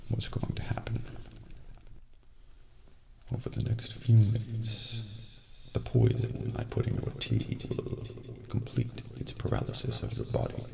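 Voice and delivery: usual speaking voice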